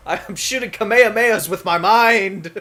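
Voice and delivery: laughing while talking. Real, not acted. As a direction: giggle through the line. Laughing at the game.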